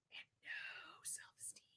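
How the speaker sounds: hushed confiding whisper; whispered aside. Real, not acted. Telling viewers a surprising truth, in a whisper. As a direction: lean in and whisper it like a secret.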